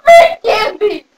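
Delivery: slow, strained character croak; bunny fairy voice. Acted. Bunny fairy bargaining for candy, strained put-on voice. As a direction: drag it out in an odd sick voice.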